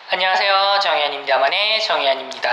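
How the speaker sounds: tinny, fast telephone filter; as if heard through a phone speaker. Shown as a demonstration. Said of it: "전화기에 대고 말하는 소리로도 가능해요".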